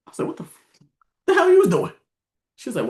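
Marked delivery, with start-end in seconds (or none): 0.3-1.9 retold shock. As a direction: cut off, then a high, loud outburst. Retelling shock at account balance.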